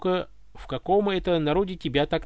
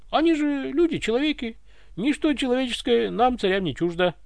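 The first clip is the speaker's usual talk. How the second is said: dignified; 8 semitones higher than usual. Acted. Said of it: "С достоинством отозвался царь".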